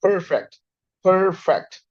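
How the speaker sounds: परफेक्ट with a hard retroflex ठ at the end; परफेक्ट, ending in ठ, not t; perfect with retroflex t. Shown as a demonstration. Teacher repeats परफेक्ट with ठ ending. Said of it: "पे भी ठा की sound आएगी".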